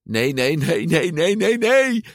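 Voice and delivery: rapidly rising string of cries; panicked. A narrator's reading. Boy sees scales appear in the rain, rising panic. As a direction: build from worried to high, loud alarm.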